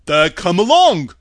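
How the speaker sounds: big, loud, welcoming shout; hearty call. Acted. Scarecrow welcomes the Tin Woodman along, loud and hearty. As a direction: cheer the new friend on, heartily. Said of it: "said the Scarecrow heartily".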